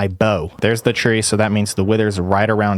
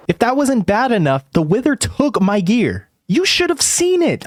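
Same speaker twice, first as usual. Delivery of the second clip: higher, worked-up disbelief; can't believe what just happened; incredulous; 11 semitones higher than usual. Real, not acted. Gamer telling viewers about the one-shot, high incredulous voice.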